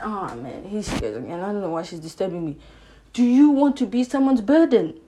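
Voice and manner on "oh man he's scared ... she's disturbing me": low, soft imitation of a complaining guy; lightly voiced weary boyfriend; guy confiding in friend. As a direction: grumble quietly, pitch dropped, as a man to his mate. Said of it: "he'll go to his friend".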